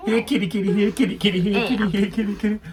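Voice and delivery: high, loud sing-song cat call. Pretend. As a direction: call the kitty from across the yard. Calling a cat.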